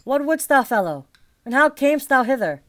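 guard barking who goes there; sharp challenge. Acted. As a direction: challenge strangers at the door. Keeper challenging intruders.

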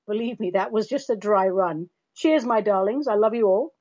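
ordinary talk

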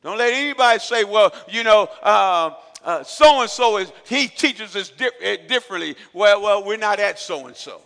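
preaching at full force. Real, not acted. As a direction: thunder it at the congregation.

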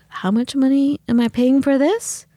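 can't believe what you're being charged for; incredulous. Retold or imitated. Patient retelling her shock at paying for a meet-and-greet, incredulous. Acting out indignant reply to doctors.

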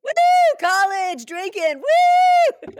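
put-on high drunk college whoop; party whoop. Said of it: "recreate the whole thing".

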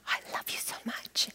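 breathless whisper, no voice at all; whispered vow. Retold or imitated. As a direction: breathe it like a tender wedding secret. Wedding vow, tender moment.

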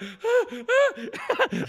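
high whimpering sobs; mock crying. Scared little brother in the back row, mock sobs. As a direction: imitate a frightened kid's whimper. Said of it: "He was crying".

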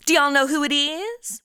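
put-on southern accent. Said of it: "I always start talking like 'em".

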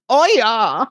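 high, loud character catchphrase cry; big, high-pitched drawn-out exclamation; Grat's catchphrase. Acted. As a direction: belt it out as an iconic catchphrase. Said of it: "died in infamy with his catchphrase".

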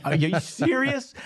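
a coach laughing at a crazy schedule, high incredulous squeal. Retold or imitated. Laughing re-enactment of disbelief.

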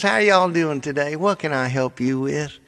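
put-on high-pitched friendly Southern accent; friendly Southern agent. Southern phone agent greeting a caller, friendly Southern accent. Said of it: "they're just the friendliest things".

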